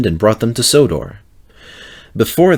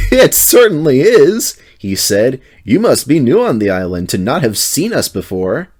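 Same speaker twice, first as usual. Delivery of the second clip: high, louder amused driver voice; 5 semitones higher, 3 dB louder than usual. A narrator's reading. Mavis's driver amused at the new lorry driver. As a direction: tease the newcomer with a grin. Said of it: "Mavis's driver laughed".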